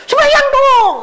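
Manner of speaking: urgent plea. Retold or imitated. Aunt's family urging prayer.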